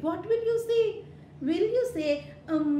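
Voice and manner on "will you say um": usual speaking voice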